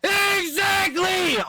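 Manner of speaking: long, high, stretched shout of agreement; yelling agreement at the clip, long and high; drawn-out shout. Real, not acted. Streamer agrees with the clip, stretched high shout.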